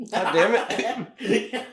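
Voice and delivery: slow, high, laughing god damn it; laughing frustration. Real, not acted. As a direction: laugh through the curse. Laughing mock frustration at spoiler.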